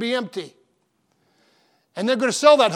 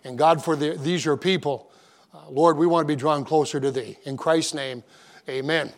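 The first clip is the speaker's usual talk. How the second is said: pastoral prayer; 3 semitones lower than usual. Acted. Opening prayer before the sermon.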